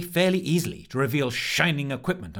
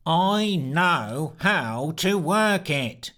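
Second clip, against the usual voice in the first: monotone yell; 5 semitones higher, 3 dB louder, much slower than usual. Acted. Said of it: "yelled Sneer, ... still very monotonous".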